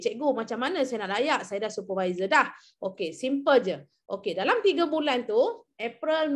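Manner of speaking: ordinary talk, nothing marked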